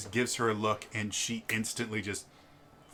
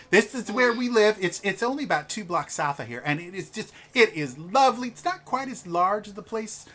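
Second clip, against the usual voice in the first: louder, higher, insistent; gushing noblewoman; 9 semitones higher, 8 dB louder than usual. Acted. A noble wife insisting the players come to dinner, louder and higher.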